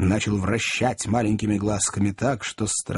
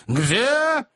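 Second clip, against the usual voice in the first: very high one-word question; jealous interrogator, pitch leaping; insistent demand; 13 semitones higher than usual. A narrator's reading. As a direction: press harder for an answer. Magnus presses the question.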